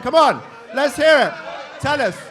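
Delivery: shouted, dragged-out rally; calling out. Real, not acted. MC yelling at the room to speak up. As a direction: whip the crowd up loud.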